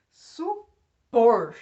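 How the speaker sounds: "Supor" split, with a loud POR; "Supor" as su, pause, POR; stress on last syllable. Shown as a demonstration. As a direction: punch the final syllable of "Supor". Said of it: "Última sílaba mais forte".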